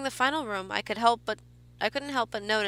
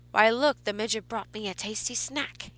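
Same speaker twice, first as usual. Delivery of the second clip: put-on a light German accent, gravelly and teasing. A narrator's reading. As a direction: tease the kids in a German-accented growl. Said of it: "in a gravelly voice that was thick with a German accent".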